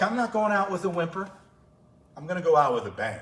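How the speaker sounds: imitating an athlete refusing to back down; defiant declaration. Athlete declares she'll go out with a bang, defiant.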